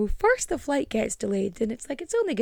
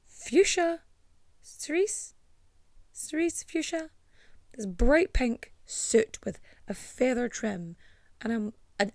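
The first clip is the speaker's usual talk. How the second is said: ordinary talk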